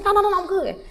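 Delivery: high-pitched, brushing off a worry; reenacted quick protest; hasty reassurance. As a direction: wave the friend off in a high voice. Reassures alarmed friend.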